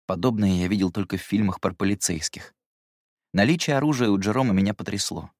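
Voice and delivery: usual speaking voice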